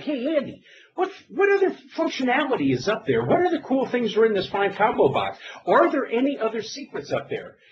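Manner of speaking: high, bright-eyed mock eagerness, pitched well up; eager question to a friend. Calling Andy on the Visual Studio team, eagerly asking for secrets. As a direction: play it like a kid begging for secrets. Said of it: "and I'm like".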